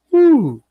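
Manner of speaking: sharp high amazed whew; whistle-like whew of amazement. Real, not acted. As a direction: blow out a high, loud whew of disbelief. Amazed reaction to the revelations.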